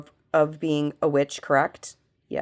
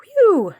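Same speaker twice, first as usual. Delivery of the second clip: relieved whew; 8 dB louder than usual. Real, not acted. Relief after spirit answers no.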